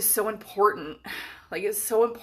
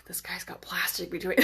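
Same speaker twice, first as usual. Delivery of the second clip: hushed whisper to a friend; whispered aside; 7 dB quieter than usual. Retold or imitated. Telling Becca about the guy in a hushed whisper. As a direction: breathe it out, half secret. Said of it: "I was like".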